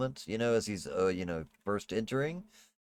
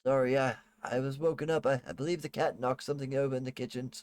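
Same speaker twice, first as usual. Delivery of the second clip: nervous maid; 5 semitones higher than usual. Acted.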